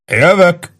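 high, quick bellowed reply; narrated bellow, pitch jumped up. A narrator's reading. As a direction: holler back that you're on the way. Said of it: "bömbölte Warden".

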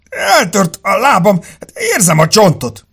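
high, loud wail of pain; tearful agony. A narrator's reading. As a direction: wail it like a badly wounded man. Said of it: "A férfi szemében könny csillant". (síró, kínlódó, magas hangú jajgatás)

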